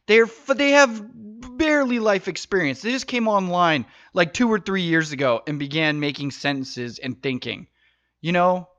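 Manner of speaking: ordinary delivery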